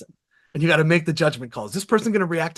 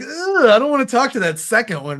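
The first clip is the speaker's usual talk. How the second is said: higher, put-upon "ugh" of a prospect; mock reluctant groan; 8 semitones higher, 5 dB louder than usual. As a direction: groan like you dread the call. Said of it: "It sounds a little like".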